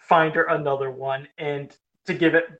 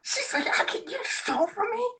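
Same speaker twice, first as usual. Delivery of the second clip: shrill high disbelieving cry; wife learns he stole her toy, high and shocked; freaking out; 6 dB quieter than usual. Retold or imitated. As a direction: squeal in laughing disbelief. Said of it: "she's freaking out and laughing".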